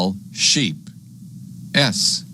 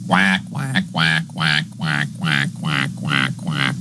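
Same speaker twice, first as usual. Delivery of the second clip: rapid string of quacks, sinking lower; duck quacking; 4 semitones lower than usual. Pretend. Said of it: "goes the duck".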